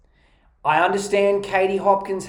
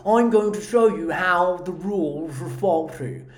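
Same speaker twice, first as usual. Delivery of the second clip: mocking British accent, big-lipped; exaggerated, irritating British caricature; Katie Hopkins. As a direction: put on a mock British voice. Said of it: "She's like".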